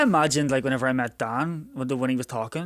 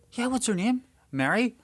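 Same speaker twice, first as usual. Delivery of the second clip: high-pitched feigned recognition; mock-eager recognition; 12 semitones higher than usual. Teasing people who ask about an aunt in Cork, mock-eager. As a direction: play along eagerly, voice lifted high. Acting out stock joke reply.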